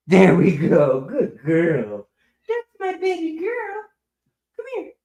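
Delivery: high cooing praise for a pet. Real, not acted. Owner praises the cat for fetching, baby-talk praise. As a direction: rise into a tiny high pet voice.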